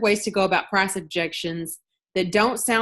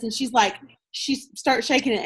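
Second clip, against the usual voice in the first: usual speaking voice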